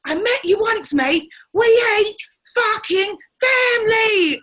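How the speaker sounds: high, loud, drawn-out shout; retold shout. Brother-in-law shouting down the pushy relative, loud and slow. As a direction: yell it back at him, furious and final. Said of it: "he shouted him".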